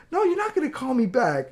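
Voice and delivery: put-on high, loud child's outburst; imitated defiant daughter. Daughter argues with mom over the phone, defiant yell. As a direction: mimic a kid yelling back at mom. Daughter refuses mother's brush-off.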